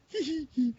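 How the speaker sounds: put-on high-pitched teenage giggle; imitating a schoolgirl titter; mock girly giggle. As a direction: giggle like a thirteen-year-old girl. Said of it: "I just giggled like a thirteen-year-old girl".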